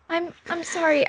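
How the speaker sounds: put-on high, stammered child's apology; sheepish young girl; apologetic little girl. Girl apologizing for toppled books.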